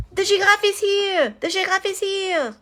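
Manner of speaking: high, loud excited cry; shouting crowd. A narrator's reading. Parisians spotting the giraffe, shouting with joy. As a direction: call out the news to everyone. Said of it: "they shouted".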